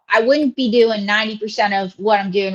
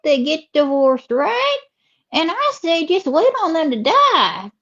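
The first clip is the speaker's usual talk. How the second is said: swooping Southern drawl; Southern lady character; 7 semitones higher, slower than usual. Acted. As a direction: stretch the vowels like a chatty Southern belle. Host voices Ruby.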